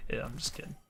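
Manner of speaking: hushed retraction; whisper. Real, not acted. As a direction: breathe it, taking it back. Apologising after a joke.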